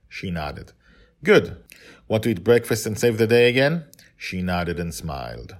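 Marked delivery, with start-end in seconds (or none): 1.2-3.8 higher, louder, faster and bright; father cheering up his daughter for breakfast; cheerful father. A narrator's reading. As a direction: cheer her up, quick and eager.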